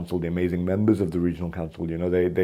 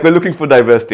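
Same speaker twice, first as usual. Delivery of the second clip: louder and higher, laughing through it; laughing mock welcome; 6 semitones higher, 11 dB louder, faster than usual. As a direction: gleefully play the eager recruiters. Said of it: "so they're like".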